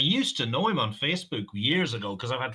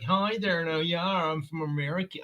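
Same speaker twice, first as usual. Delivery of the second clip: mock Americanized Dubliner. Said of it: "some in south side of the city that are like".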